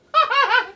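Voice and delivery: high burst of laughter. Real, not acted. Laughing off the burn.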